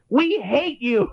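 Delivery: slow, high, deadpan heckler; slow mocking heckler line. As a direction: drag it out like a sullen heckler. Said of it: "he goes".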